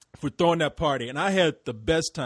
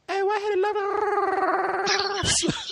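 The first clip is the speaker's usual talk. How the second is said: very slow, much higher, sing-song British voice; mock British accent; much slower than usual. Host imitating Chantel's greeting, slow, high mock British. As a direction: draw it out like a sweet English lady. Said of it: "she's gonna say".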